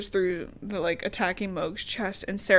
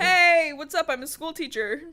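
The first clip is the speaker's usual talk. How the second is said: mock casual act; 7 semitones higher, 3 dB louder than usual. Pretending to act natural.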